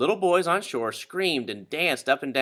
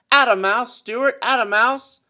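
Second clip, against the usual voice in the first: loud, high cheer across the water; cheering call; 9 semitones higher, 7 dB louder than usual. A narrator's reading. Crowd on shore cheering Stewart on, loud high calls. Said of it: "people cheering for him and calling".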